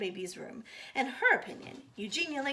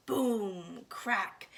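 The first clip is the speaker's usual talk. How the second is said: ordinary delivery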